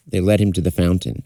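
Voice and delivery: ordinary delivery, nothing marked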